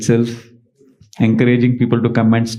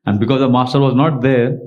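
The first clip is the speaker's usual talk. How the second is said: ordinary talk, nothing marked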